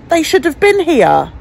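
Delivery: slow, loud and high, leaning on been; exasperated insistence; indignant emphasis. Real, not acted. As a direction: drive home that they should have been here. Insisting they should have come.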